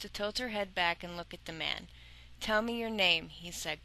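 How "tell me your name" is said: ordinary delivery, nothing marked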